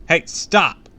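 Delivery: high, loud command to stop; shouted order. A narrator's reading. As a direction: shout to halt a child. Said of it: "Sabrina shouted".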